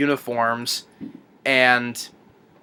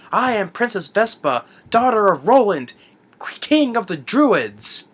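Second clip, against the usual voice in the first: higher, grand, drawn-out proclamation; haughty princess; 7 semitones higher than usual. Retold or imitated. Retold princess introducing herself to rescuers, mock regal.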